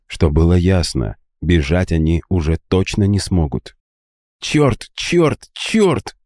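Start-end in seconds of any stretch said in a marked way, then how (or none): 4.4-6.2 high, strained cursing to herself; high frustrated curse; cursing under her breath. A narrator's reading. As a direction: swear in rising frustration, pitched high. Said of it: "выругалась про себя Барракуда".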